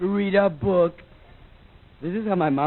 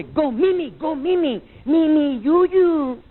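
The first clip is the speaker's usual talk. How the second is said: higher, louder, insistent scolding; scolding mom; 9 semitones higher, 5 dB louder, slower than usual. Retold or imitated. Mexican mom scolds kid to bed, higher and louder. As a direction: push the kid to bed, louder each time. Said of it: "My mom would say".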